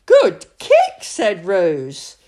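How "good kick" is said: put-on much higher and louder, delighted child; excited cheer.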